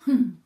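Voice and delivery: loud grumpy hum; a reluctant bear's grumble; grumbling hum. A narrator's reading. Bear grumbling at the mouse's idea, loud grumpy hum. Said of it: "ronchonna l'ours".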